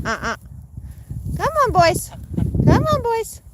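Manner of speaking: high sing-song call; calling pets. Real, not acted. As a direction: coax them in a high voice.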